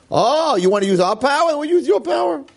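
high, taunting comeback; mock retort. Moshe taunting Bilam, high mock retort.

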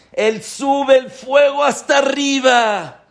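high, loud, slow shout; fiery shout. Real, not acted. Preacher shouting to the congregation that the fire rises to heaven. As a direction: shout it up to the sky like rising flames.

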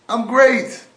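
loud, high cheerful yell; man in the pit yells up, oddly happy; screaming up. Acted. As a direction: shout it up from the bottom of the pit. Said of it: "The guy screams up".

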